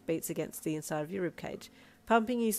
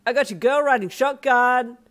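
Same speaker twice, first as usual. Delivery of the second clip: loud, higher gloating yell; taunting shout; 6 semitones higher, 12 dB louder than usual. Acted. Sedano gloating to Darby while driving off, loud taunt. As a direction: crow it out the window at him.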